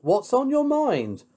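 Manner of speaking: high, composed lady's voice. Acted. Heroine agrees to help Pig, high and composed.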